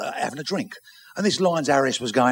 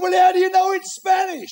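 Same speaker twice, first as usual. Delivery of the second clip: high, loud holler; shouted question; 6 dB louder than usual. Acted. Captain challenging the lookout up above, shouting. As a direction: yell the question up the mast.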